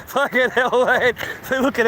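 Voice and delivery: amused at yourself; laughing. Real, not acted. Laughing at watching waves, amused. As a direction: laugh through the words.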